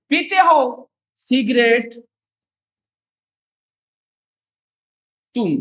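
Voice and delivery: slow insistent पीते हो cigarette; stressed and slow. Shown as a demonstration. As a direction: drag out पीते हो to convince. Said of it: "sir ऐसा बोल रहे हैं". (धीमे ज़ोर के साथ)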